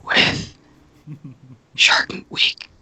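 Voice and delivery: breathy announcer whisper. Real, not acted. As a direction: whisper the host intro. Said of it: "The whisper".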